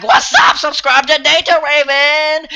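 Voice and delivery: high, slowed hyped YouTuber greeting; playful fake vlogger shout-out; youtuber intro.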